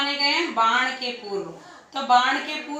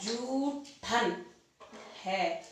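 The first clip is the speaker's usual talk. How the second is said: झूठन drawn out very slowly and softer; slow dictation; 4 semitones lower, 9 dB quieter, much slower than usual. Shown as a demonstration. Teacher dictating the corrected word to students, slow and soft. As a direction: say झूठन slowly so the class can write it. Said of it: "झूठन लिख दो".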